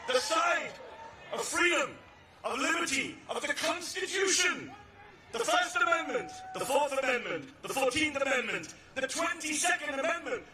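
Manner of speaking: rally the crowd to your side; rally oratory. Acted. Rally speech chant.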